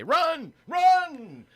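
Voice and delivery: high urgent shout of run; shouted alarm. Pretend. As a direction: yell it like a frantic alert. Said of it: "phone to start vibrating and say".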